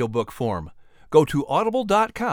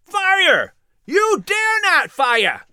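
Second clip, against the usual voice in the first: much higher, slow, shouted defiance; angry crowd shouts; much slower than usual. Acted. Angry voices in the crowd shouting at the soldiers. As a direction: yell it from the mob, furious. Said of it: "other angry voices echoed".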